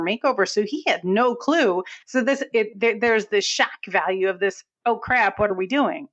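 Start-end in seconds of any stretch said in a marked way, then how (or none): none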